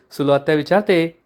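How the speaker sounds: usual speaking voice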